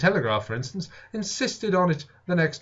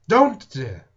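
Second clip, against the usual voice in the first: higher, louder pleading wife; lightly voiced anxious wife; 8 semitones higher, 6 dB louder than usual. A narrator's reading. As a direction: beg him to stop, worried. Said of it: "said my wife, knitting her brows".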